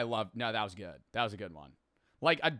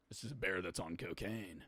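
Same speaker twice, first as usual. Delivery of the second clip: low, quiet mock movie pitch; understated, low-voiced quote; hushed pitch; 7 semitones lower, 13 dB quieter than usual. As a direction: drop right down and state the premise softly.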